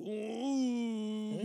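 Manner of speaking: high, drawn-out frightened "uuuh" moan; scared reaction. Retold or imitated. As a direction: whimper in mock dread at a noise. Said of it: "tú escuchas el ruido y tú". (gemido de miedo fingido)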